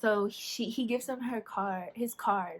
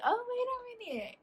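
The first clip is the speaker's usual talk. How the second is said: intrigued surprise; 14 semitones higher, 4 dB quieter than usual. Retold or imitated. Spotting a cute profile.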